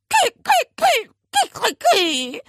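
high loud squawks, fading at the end; protesting squawk. Acted. Parrot protesting the aunt's threat, loud squawks. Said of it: "protestierte der Papagei".

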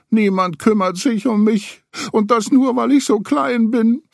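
high, teary, sniffly voice; sniffling Piglet. A narrator's reading. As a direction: sniffle through it, feeling sorry. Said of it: "schniefte Ferkel".

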